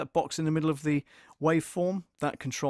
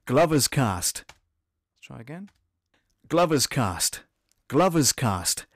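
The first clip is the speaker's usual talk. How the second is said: radio announcer; 7 dB louder, much slower than usual. Acted. Repeated loud ident takes.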